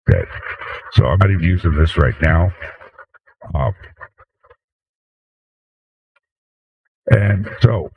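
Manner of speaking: heavily compressed, echoey mic sound; over-compressed mic. Real, not acted. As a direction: voice through a stacked compressor chain with echo. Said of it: "God, it's echoes".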